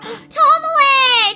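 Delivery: ordinary talk, nothing marked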